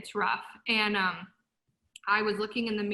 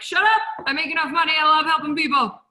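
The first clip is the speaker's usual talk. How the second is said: loud, high, fed-up snap back; loud defiant comeback; defiant retort; 5 semitones higher, 8 dB louder than usual. Retold or imitated. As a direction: shout them down, fed up. Retorting to advice-givers.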